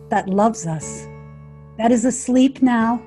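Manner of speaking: ordinary delivery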